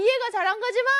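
high-pitched, put-on bafflement; mock frustration. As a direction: whine it, baffled by your dad. Acted frustration at not understanding dad. (답답한 척 높은 목소리로)

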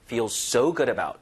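"so" given extra force; stress on so. Shown as a demonstration. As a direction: put the weight on "so" before "good". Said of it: "요거 so에 힘주고 이것도". ("so"에 힘주어)

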